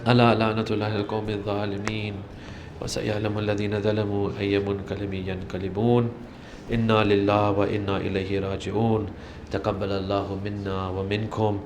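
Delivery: fast, low Arabic formulas rattled off; rapid closing recitation. Acted. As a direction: murmur the closing prayers quickly.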